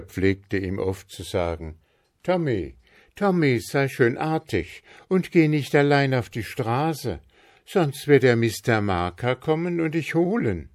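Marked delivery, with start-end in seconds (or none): 2.2-10.7 a lightly voiced mother, raised higher, warning. A narrator's reading. Mother warns Tommy about Mister Marker, lightly voiced higher. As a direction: caution the child with a hint of a bogeyman threat.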